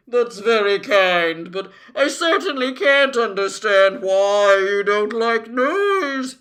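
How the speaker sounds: loud, wailing creature voice; woeful din. A narrator's reading. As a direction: groan on, rising at the end. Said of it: "groaned the din".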